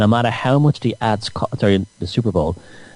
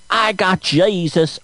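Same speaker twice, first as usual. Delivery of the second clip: mock born-again believer; 6 semitones higher, slower than usual. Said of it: "even if they think".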